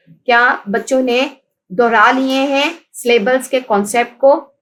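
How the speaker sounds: ordinary delivery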